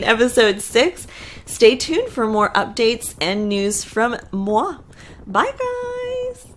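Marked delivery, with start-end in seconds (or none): 5.3-6.5 very high, soft farewell; sing-song sign-off. Real, not acted. Host signing off to viewers, very high sing-song. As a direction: trill a light, high-pitched goodbye.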